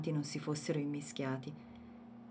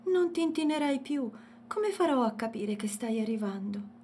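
put-on child's voice; 7 semitones higher than usual. A narrator's reading. Child voice Youssef.

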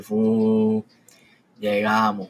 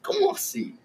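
incredulidad; 5 dB quieter than usual. Retold or imitated. Reacción incrédula.

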